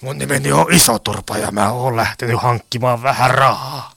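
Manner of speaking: matala, hidas roiston ääni; low-voiced crook introducing himself; Iso Turpa. (matalaääninen roisto)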